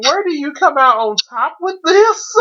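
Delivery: teasing imitation of a father; mock father quote. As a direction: throw it at him in a high mock voice. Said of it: "And Father's like".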